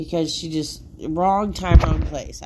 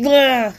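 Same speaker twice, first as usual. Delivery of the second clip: frustrated groan; 5 semitones higher, 9 dB louder than usual. Real, not acted.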